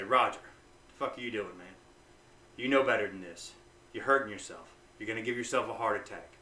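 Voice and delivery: low, soft self-reproach; negative self-talk. Shown as a demonstration. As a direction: scold yourself quietly in the mirror. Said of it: "what I mean by negatively influencing yourself".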